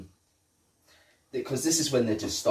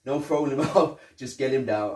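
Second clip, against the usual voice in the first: raised, higher bossy partner voice; mock stern order; 6 semitones higher, 7 dB louder than usual. Murtaugh warning Riggs before the jump, louder and higher. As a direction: bark the rules like a senior cop.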